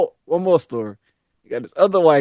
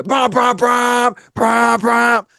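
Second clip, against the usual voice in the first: imitating engine revving; 9 semitones higher, 4 dB louder, much slower than usual. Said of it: "So I hear".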